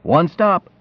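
mild yell. A narrator's reading. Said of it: "pull to the curb and yell".

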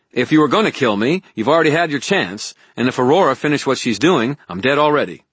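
heated, insistent man pleading his case; Harry arguing heatedly. Acted.

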